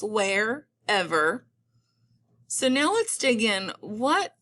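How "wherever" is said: WHEREVER split slowly into "where" and "ever"; syllable by syllable. Shown as a demonstration. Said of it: "I do hear two syllables".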